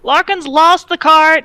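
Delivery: loud, slow, high holler; shouted relay. Acted. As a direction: holler it along the wall. Guard passes shout along the wall.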